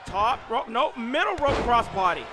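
excited live play-by-play call; wrestling commentator. Acted. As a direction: call the match like a ringside announcer.

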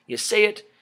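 usual speaking voice

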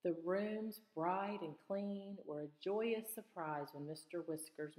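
plain reading voice

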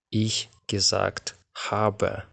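drawn out, each word separate; very slow demo. Shown as a demonstration. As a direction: spell out Ich gesagt habe slowly for the class. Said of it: "teacher isolates the second clause". (дуже повільно, по словах)

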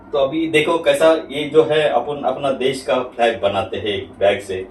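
ordinary talk

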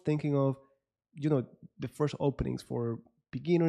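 usual speaking voice

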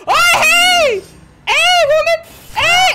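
high, loud startled yelling; startled shout. Real, not acted. Gamer yelling at the tall woman, startled shout. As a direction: yell in alarm at her.

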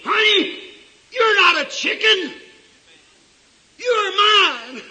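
high, loud, full-voiced preacher's cry; mother eagle's scream. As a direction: scream it down to your lost child. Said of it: "and she screamed".

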